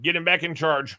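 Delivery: loud, rising mock command; rally the agents like a hammy boss.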